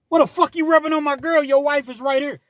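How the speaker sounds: high, loud angry yell; enraged boyfriend shouting; angry demand. Retold or imitated. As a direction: confront him, way up high. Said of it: "angry fiance confronts friend".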